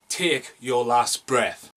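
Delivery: very slow, drawn-out tagline reading; trailer tagline. Acted. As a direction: stretch each word like a movie trailer. Reads tagline slowly, unlike later mention.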